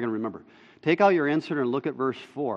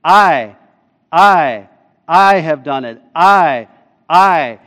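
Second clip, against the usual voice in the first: each "I" punched loud and set apart; emphatic; 9 dB louder, much slower than usual. Shown as a demonstration. Preacher counting God's pronouns in the passage, emphatic. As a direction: hammer every "I" like a drumbeat, falling. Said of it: "It's emphatic".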